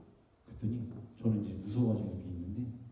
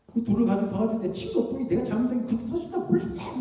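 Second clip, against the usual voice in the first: high, loud mock protest; child's protest; 12 semitones higher, 7 dB louder than usual. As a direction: lightly imitate a child pleading his case, pitched up. Child protesting to mother, retold. (억울해하며 항변하는 아이 흉내)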